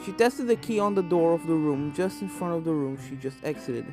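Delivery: ordinary delivery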